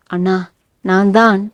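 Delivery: timid, mildly scared confession; frightened. A narrator's reading. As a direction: start explaining to an angry brother, cowed. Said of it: "பயந்தவளாக மிரண்டு சொல்ல". (பயந்து மிரண்ட குரலில்)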